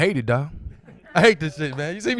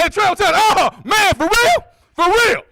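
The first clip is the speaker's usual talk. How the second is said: high, loud, offended protest; offended friend; 12 semitones higher, 8 dB louder than usual. Retold or imitated. Friend called by real name, offended and loud. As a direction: act insulted at being called by name. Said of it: "He got mad".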